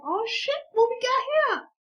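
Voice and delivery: very high-pitched exclamation; astonished reaction. Retold or imitated. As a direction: squeal it in mock amazement. Reacts to big bottle of pills.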